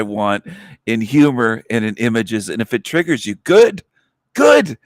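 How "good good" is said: excited. Real, not acted. Gleeful taunt at triggered viewers.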